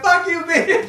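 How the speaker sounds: high, laughing cuss-out; laughing mock retort. Quisha snapping back at the director, laughing. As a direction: fire it back through laughter. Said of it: "Quisha said".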